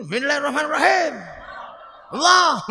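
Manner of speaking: fierce, abrupt recitation; forceful recitation. Retold or imitated. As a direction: bark it out like stern Umar. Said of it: "Kalau Sayyidina Umar".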